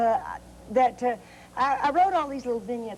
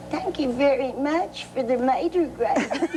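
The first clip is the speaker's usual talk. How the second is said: put-on sweet little girl. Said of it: "she says".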